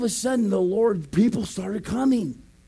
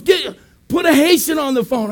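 raised, louder command; imitated urgent order; 6 semitones higher, 6 dB louder than usual. Guard demanding a local on the phone, urgent loud order.

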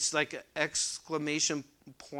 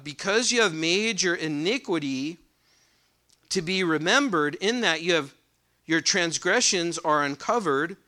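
raised, louder reading voice from the pulpit; scripture reading; 3 semitones higher, 7 dB louder than usual. Acted. Preacher reading Ezekiel aloud to the congregation, proclaiming.

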